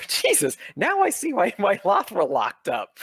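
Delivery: a high, loud burst of disbelief; he suddenly gets why; startled exclamation. Acted. Shopkeeper realizing who the customers are, startled and loud. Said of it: "He goes".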